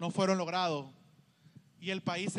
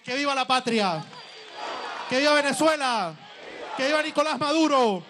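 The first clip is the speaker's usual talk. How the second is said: rising rally shouts, each cheer louder; booming balcony speech; 3 semitones higher, 4 dB louder than usual. Real, not acted. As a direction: shout the vivas to the crowd. (discurso de balcón a todo pulmón)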